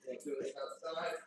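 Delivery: ordinary talk, nothing marked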